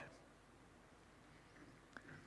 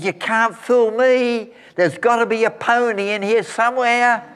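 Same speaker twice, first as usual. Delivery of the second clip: put-on cheeky happy boy; 11 semitones higher, 4 dB louder than usual. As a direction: chuckle it like a sly optimistic kid. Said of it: "He said".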